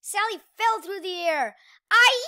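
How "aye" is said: high loud falling scream. Acted. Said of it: "she screamed".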